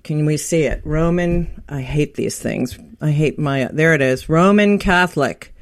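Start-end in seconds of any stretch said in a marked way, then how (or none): none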